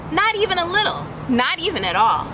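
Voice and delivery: ordinary delivery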